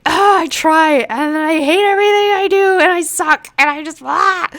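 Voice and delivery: high, loud mock-whiny wail; hammed-up whiny self-pity; mock whiny artist. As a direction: whine it like a despairing artist. Acting out meme caption.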